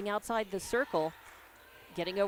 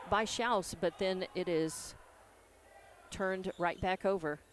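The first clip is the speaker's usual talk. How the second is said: usual speaking voice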